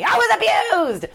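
high indignant mock cry; mock wail. Fern accusing Dawn of spying, high mock wail. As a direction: wail it like a wronged child.

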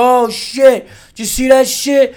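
hammed-up crowd gasping at the twist; mock excited fans. Mocking moviegoers who are shocked the heroes came back, loud and excited. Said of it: "And everyone's like".

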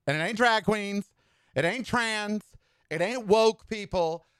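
normal talking voice

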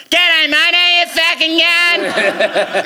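exaggerated Australian ocker greeting, stretched out with a laugh; broad ocker Australian. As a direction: lay the ocker Australian on thick like a cartoon Aussie. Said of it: "I fucking Uncle Ocker it up".

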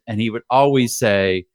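normal talking voice

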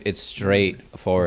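normal talking voice

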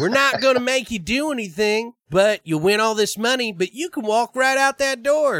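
high-pitched put-on sales pitch; mock game host. Bosses tempting players with money, high-pitched mock voice. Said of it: "It'd be like".

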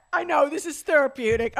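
crying. Real, not acted. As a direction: still crying while joking. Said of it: "do I have to cry".

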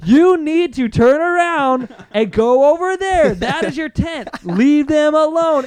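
high-pitched, emphatic retold scolding; mock exasperated orders. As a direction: spell it out for a drunk friend who won't listen. Retelling Larissa ordering Jason away.

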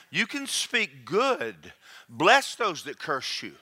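preaching. Acted. Preacher to congregation, rising into fervent preaching. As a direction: preach it with building fervor.

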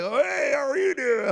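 high, hollered goofy greeting; imitated eccentric man. Homeless man greeting a stranger, imitated high and eccentric. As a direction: put on an odd, high-pitched voice.

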